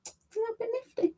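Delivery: tiny squeaky voice, hushed; squeaky echo. Shown as a demonstration. Repeating a chat comment to herself.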